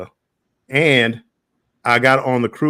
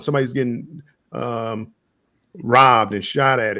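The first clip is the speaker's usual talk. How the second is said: usual speaking voice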